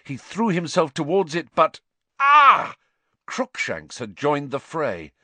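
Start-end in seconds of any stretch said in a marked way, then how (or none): 1.5-2.8 high, loud cry of pain. A narrator's reading. Harry hurt by cat claws.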